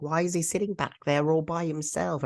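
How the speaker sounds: imitated puzzled girl. Omega asking about upset Gunji, imitated high-pitched puzzled question.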